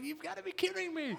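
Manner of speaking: high, laughing disbelief; laughing at mom's confession, disbelieving. Retold or imitated. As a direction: squeal it through laughter.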